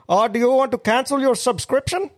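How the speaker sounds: high, hammed-up Indian call-centre voice; mock Indian accent. Mate imitating the Indian scam caller, high mock accent. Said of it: "this fucking Indian bloke".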